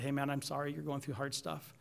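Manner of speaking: retold tender words to an upset friend; gentle comfort. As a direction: soothe him like an arm round his shoulder. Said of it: "I lovingly put my arm around him and said".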